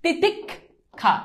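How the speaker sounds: very slow "তিতিক্ষা", titik, long gap, kha; teacher breaking "তিতিক্ষা" into titik and kha; split pronunciation. Shown as a demonstration. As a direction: stop on the k of "তিতিক্ষা" before releasing kha. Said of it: "তুমি normally উচ্চারণ করো".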